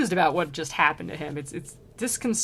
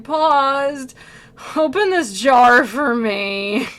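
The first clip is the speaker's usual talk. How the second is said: demanding plea; 4 semitones higher, 10 dB louder, much slower than usual. Retold or imitated. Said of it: "was like".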